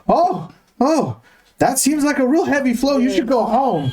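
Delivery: high, overly sweet manager voice; nice store manager. Pretend. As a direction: gush with fake concern, high. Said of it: "he sees the blood ... goes like".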